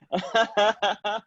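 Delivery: breaking into a laugh; amused laugh. Real, not acted. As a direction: laugh at yourself, high and light. Laughing at own ghost voice.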